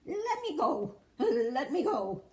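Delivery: high, loud shout of a captured little man; high squealing leprechaun shout. A narrator's reading. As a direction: yell to be let go. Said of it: "the leprechaun shouted".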